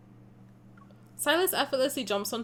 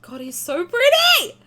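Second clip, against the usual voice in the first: high, loud gushing with a laugh; swooning squeal; 10 semitones higher, 10 dB louder than usual. Real, not acted. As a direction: gush over him, laughing. Swooning over game character.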